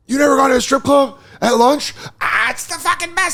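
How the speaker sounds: high excited imitation of a friend; excited drunk buddy. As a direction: gush about lunch strip clubs. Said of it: "And List was like".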